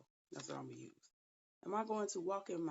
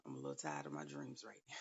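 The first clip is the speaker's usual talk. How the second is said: much lower, fast and soft, thrown away; low mumbled aside; 12 semitones lower, 8 dB quieter, faster than usual. Real, not acted. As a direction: mumble it quickly under your breath. Tired aside about dreams.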